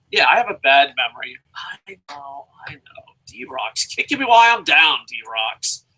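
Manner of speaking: usual speaking voice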